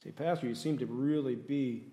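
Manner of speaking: ordinary delivery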